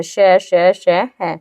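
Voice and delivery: श drawn out as a long breathy hiss; hissing श demo. Shown as a demonstration. Teacher showing warm air on fricatives, hissed श. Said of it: "गर्म हवा".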